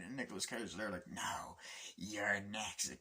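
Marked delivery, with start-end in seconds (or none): 1.1-2.9 soft unvoiced whisper; whispered menace; whispered threat. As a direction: breathe the threat.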